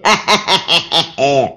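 short cackling laugh; witch cackle. Acted. Baba Yaga cackles after her warning. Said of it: "the witch".

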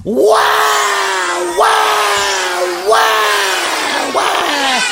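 high, long comic wails; imitated baby wail. A narrator's reading. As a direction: bawl like a baby between breaths. Imitates the baby wailing again.

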